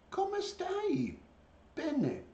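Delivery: high singsong Italian prompt; pet-talk voice. Real, not acted. Owner getting a bird to speak Italian, high pet-talk.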